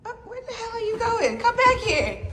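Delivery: high, laughing call after the dog. Real, not acted.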